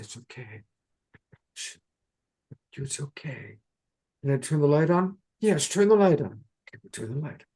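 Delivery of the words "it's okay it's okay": much softer, gentle reassurance; soothing comfort. Acted. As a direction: hush a scared child in your arms. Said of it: "You're gonna hold her and say".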